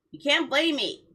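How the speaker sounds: ordinary delivery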